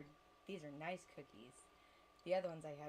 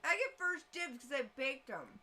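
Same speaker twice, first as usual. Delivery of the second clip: playful boast; 7 semitones higher, 12 dB louder, slower than usual. Real, not acted. Playful claim, slow and loud.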